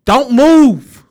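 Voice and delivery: loud, high mocking take-off of a lyric; mock song command. Host parodying the slow down song for laughs, mock command. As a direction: belt it out like a crude order. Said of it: "that slow down song".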